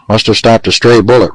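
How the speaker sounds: ordinary delivery, nothing marked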